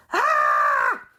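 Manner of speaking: loud, high-pitched comic scream; scientists' scream. Acted. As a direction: scream like a roomful of panicked scientists. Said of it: "they all said".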